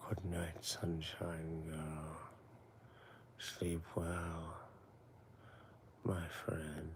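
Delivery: low, slow, soft bedtime murmur; soothing low send-off to sleep; good night voice. Pretend. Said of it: "extra special good night voice".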